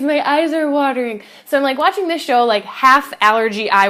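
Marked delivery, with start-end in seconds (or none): 0.0-1.2 higher, insisting it's just watering eyes; mock-defensive, raised voice; defensive excuse. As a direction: protest it's only allergies, pitched up. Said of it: "And I was like".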